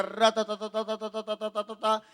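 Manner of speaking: long rapid rolling "ratatata" on one note; imitated roulette rattle. Preacher telling how the roulette spun, rattling sound effect. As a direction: rattle like a spinning wheel.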